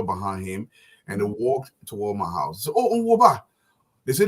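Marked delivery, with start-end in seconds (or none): 2.8-3.4 raised, higher call of greeting. Retold or imitated. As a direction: call out to him across the yard. Medicine men calling out greeting.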